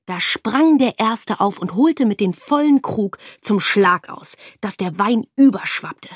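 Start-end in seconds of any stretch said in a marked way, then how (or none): none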